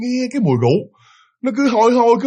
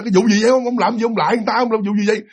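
higher-pitched, exasperated questioning; retold annoyed mother; 5 semitones higher than usual. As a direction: imitate a wife demanding an explanation. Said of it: "Mẹ ông tức rồi bắt đầu phải chạy ra". (giả giọng mẹ bực bội hỏi dồn, cao giọng)

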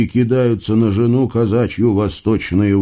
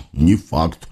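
low, sullen hog; gloomy mutter; 7 semitones lower than usual. A narrator's reading. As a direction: mutter it glumly, pitched down. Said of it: "угрюмо пробормотал хряк".

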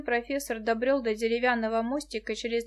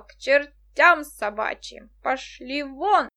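put-on slow furious drunken yelling; drunken shout; 7 dB louder, slower than usual. A narrator's reading. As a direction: bellow it out, enraged. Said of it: "ударил кулаком по перилам и закричал".

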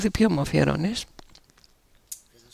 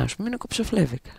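normal talking voice

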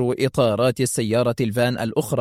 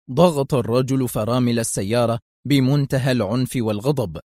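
plain reading voice

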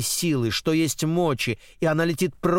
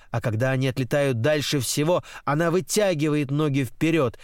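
usual speaking voice

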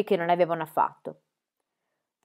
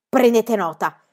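icy; 8 dB louder, slower than usual. A narrator's reading. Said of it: "con voce gelida".

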